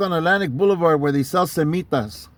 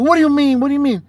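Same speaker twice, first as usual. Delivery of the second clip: higher-pitched challenging question; retold defiant comeback; 6 semitones higher, 3 dB louder, faster than usual. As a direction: throw it back as an indignant challenge. Retold defiant comeback in street argument.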